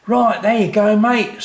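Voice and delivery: high, loud breezy workman; cheery lorry driver. A narrator's reading. Lorry driver waving Dad off, high and breezy. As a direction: toss it off to the customer. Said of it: "said the lorry driver".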